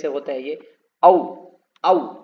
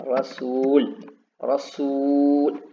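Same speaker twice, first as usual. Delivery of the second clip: रसूल and कानून stretched very slowly, vowels held long; drawn-out words; much slower than usual. Shown as a demonstration. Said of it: "खींच खींच के हमको लिखना हो". (खींचकर धीरे-धीरे बोलना)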